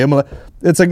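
ordinary talk

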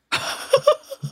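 burst of laughter. Real, not acted. Laughing at her own story.

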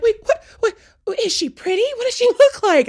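imitating a flustered girlfriend, very high; high mock-frantic string of questions; frantic questioning. Dad's girlfriend quizzes caller, high frantic imitation.

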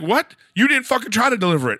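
mock outraged disbelief; retold outburst. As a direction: fire it back in disbelief. Retold phone argument with delivery.

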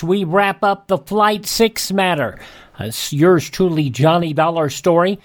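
ordinary talk

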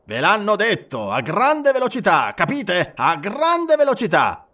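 high, forceful, drawn-out repetition; impatient insistence. A narrator's reading. Colonel cutting off Passepartout, impatient and insistent.

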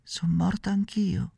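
put-on high, soft ghostly child voice; a tiny voice from the other world; otherworldly little voice. A narrator's reading. Girl at the window answering Pinocchio, ghostly and faint. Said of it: "con una vocina che pareva venisse dall'altro mondo".

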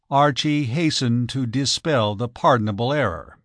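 ordinary delivery

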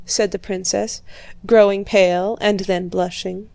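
ordinary delivery

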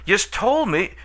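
put-on a bewildered girl protesting; astonished. A narrator's reading. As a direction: narrator voicing a surprised young girl. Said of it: "Astonishment swept over the girl's features".

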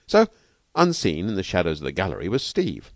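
usual speaking voice

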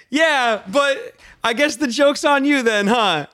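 the narrator ribbing the local right back; cocky comeback. Retold or imitated. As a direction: fire back the joke with swagger. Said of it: "I'm like".

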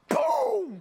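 a high, loud imitated boom of the slamming door. Dad imitating the freezer door slamming on Nora's hand, loud boom. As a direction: shout the crash sound of the freezer door.

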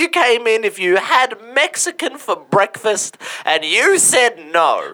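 squeaky high, slow voice; high squeaky accusation. Acted. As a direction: pitch it way up and drag it. Mock accusation of Michelle.

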